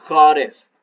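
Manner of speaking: Hotter stated loud and clear as a model word; hotter called out loud. Shown as a demonstration. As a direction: call out Hotter for the class. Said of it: "teacher demonstrates superlative form". (Hotter dicho fuerte y claro para la clase)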